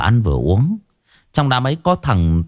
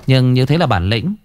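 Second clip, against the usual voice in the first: plain reading voice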